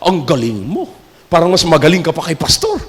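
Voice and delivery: butter up the preacher quietly; flattering whisper-soft praise. Retold or imitated. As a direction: congregant flattering a young preacher. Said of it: "Pagkatapos mag-preach".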